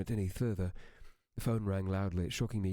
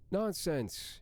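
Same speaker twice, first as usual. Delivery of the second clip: Amy's voice; 11 semitones higher than usual. Acted. Narrator voices Amy on phone.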